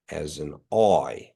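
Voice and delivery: ordinary talk, nothing marked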